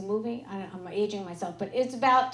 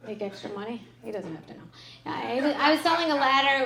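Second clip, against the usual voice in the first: ordinary delivery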